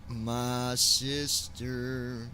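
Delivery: very slow, stretched out; drawn-out sing-song. Real, not acted. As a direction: stretch "My sister" slowly and sweetly. Repeats greeting, drawn out.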